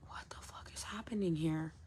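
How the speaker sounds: breathy disbelieving whisper under the breath; incredulous whisper. Retold or imitated. Whispering to herself in shock at the message. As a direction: whisper it like you can't believe it. Retold inner reaction, whispered.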